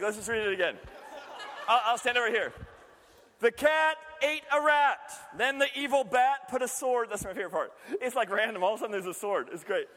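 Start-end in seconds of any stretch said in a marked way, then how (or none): 3.4-7.1 put-on playful storyteller reading a child's fable; storybook reading. Rereads the fable.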